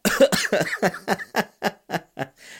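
sudden high coughing laugh; laugh-cough after swallowing a bug; choking laugh. Real, not acted. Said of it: "It's like I swallowed a bug".

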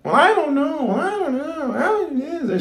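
very high put-on falsetto whine; mock whiny doubter. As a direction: mimic a doubter in a squeaky, wavering voice. Mimics negative doubter before rebuking.

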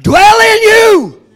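loud, ringing pulpit cadence, each phrase hammered home; full-force preaching. Acted. Preacher to his congregation, full-force shouted preaching. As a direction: preach it like a revival climax.

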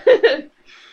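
loud, high burst of laughter; real laughing fit. Real, not acted. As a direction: crack up helplessly mid-story. Cracks up at the drowning crocodile story.

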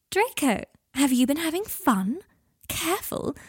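high, mock-scandalised teasing; teasing amazement. Girl teasing her guardian about going out, mock amazement. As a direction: swoop up in playful disbelief.

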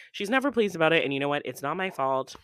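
usual speaking voice

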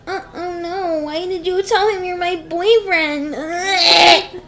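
hammed-up shrill girl throwing a fit; mocking Laura. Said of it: "and Laura was like".

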